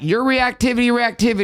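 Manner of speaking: high mocking imitation of his wife. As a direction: parrot her accusation in a high whiny voice. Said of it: "she will be like".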